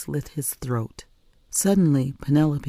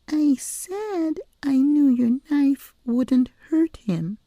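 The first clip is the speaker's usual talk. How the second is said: put-on high, slow child's voice; little girl explaining; 13 semitones higher, slower than usual. A narrator's reading. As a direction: patiently correct her.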